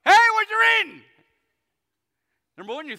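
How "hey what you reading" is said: much higher and louder, a shouted hail; loud call-out. Retold or imitated. Philip shouts up to the treasurer in his chariot, high and loud. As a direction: belt it out to the passing chariot. Retelling the deacon's call, bigger.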